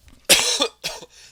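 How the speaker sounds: harsh loud coughing fit; just swallowed a bug. Real, not acted. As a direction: cough hard to clear the throat. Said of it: "I think I swallowed a bug".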